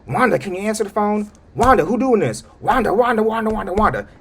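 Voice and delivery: high, loud repeated calling; calling urgently. Retold or imitated. Man watching TV calling for Wanda, high loud calls. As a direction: call her name over and over, urgently. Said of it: "he's saying".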